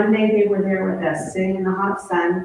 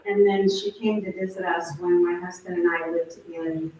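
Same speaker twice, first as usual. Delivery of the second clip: ordinary talk, nothing marked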